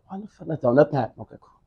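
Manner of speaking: normal talking voice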